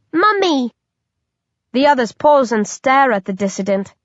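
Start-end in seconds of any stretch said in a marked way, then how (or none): 0.1-0.7 much higher, a creepy infant calling; high-pitched baby voice. A narrator's reading. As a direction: squeak it out like a monster baby. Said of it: "in its high-pitched voice".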